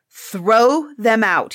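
slow, loud furious order; angry cry. A narrator's reading. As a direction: roar the order slowly. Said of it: "he cried".

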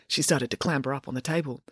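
ordinary delivery, nothing marked

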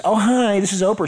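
lightly voiced breezy Oprah; a hint of a chirpy Oprah greeting; Oprah impression. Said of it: "and says".

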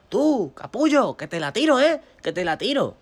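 loud, high mock warning; shouted threat. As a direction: call down a teasing threat to your brother. Shouted threat to brother from terrace.

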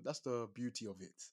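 usual speaking voice

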